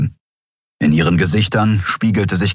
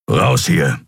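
grumbled; 5 semitones lower than usual. Acted. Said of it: "brummte der Skria".

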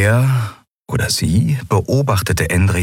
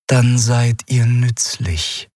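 a slow, detached otherworldly voice; a lightly voiced cold alien; cool alien being; 5 semitones higher, much slower than usual. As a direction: speak down to them without feeling. Shayang, expressionless.

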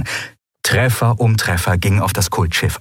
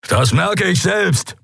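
high, drawn-out slow yell; bellowed retort; 10 semitones higher, slower than usual. A narrator's reading. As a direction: roar back over the thunder of impacts. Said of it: "brüllte Keru über die Donnerschläge hinweg".

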